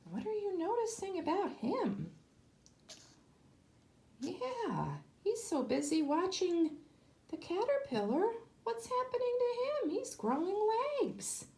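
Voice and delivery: teacher pointing out pictures, softly. Real, not acted. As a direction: gently coax the class to look. Teacher asks children about pictures.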